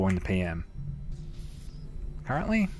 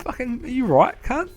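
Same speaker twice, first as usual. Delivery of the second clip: very high-pitched mock disbelief; retold incredulous comeback. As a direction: fire back at dad, squeaking up in disbelief. Acted comeback to his dad.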